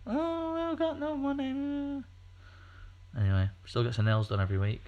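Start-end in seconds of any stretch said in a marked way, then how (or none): 0.1-2.0 high, slow whining falsetto; whiny wife voice. Retold or imitated. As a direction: whine it like a moaning wife. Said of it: "I keep telling the missus".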